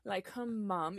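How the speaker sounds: slow, proud little cheer; a hint of a beaming mother, said slowly; proud mom. Mother praises daughter's British accent: slow, proud cheer. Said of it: "she was so proud of it".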